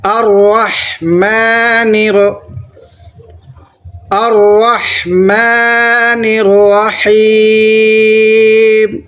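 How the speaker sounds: الرحمن الرحیم with doubled ر and long drawn vowels; slow chanted الرحمن الرحیم; tajweed recitation. Shown as a demonstration. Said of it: "را کے اوپر تشدید ہے، اس لیے ڈبل پڑھنا ہے".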